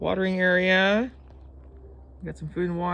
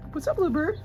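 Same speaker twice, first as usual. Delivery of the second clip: high sing-song hello; high-pitched greeting; 13 semitones higher than usual. Real, not acted.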